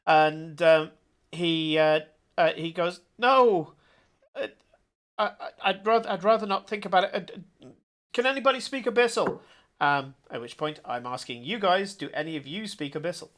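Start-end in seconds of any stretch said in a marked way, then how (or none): 3.2-9.4 flustered. Acted. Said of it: "completely shocked".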